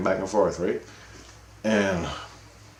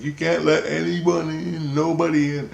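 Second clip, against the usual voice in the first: Jesus insisting; 8 semitones higher than usual. Retold or imitated. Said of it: "Jesus is like".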